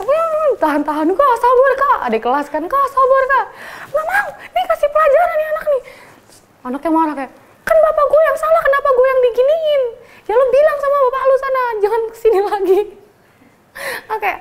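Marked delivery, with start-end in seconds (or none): 0.0-2.0 high pleading calm-down; soothing juniors. Retold or imitated. Younger classmates begging the senior to calm down, high pleading. 2.7-5.8 high pleading calm-down; soothing juniors. Retold or imitated. Younger classmates begging the senior to calm down, high pleading. Juniors calming, her refusing. 7.6-12.8 high, loud heated back-and-forth; retold argument. Teacher's son and senior shouting at each other, high loud.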